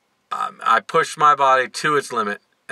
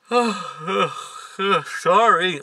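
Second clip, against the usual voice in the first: mid-yawn, drawn out; 5 semitones higher, much slower than usual. Real, not acted. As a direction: talk through a yawn. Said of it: "I'm so sorry I yawned on camera".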